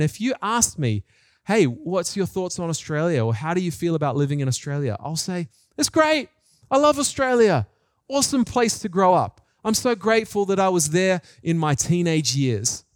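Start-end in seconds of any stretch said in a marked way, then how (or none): none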